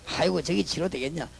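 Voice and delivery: normal talking voice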